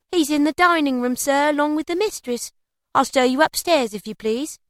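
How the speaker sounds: high-pitched maid's voice; servant girl, obliging. A narrator's reading.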